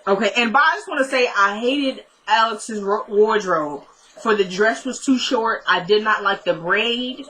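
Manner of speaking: ordinary talk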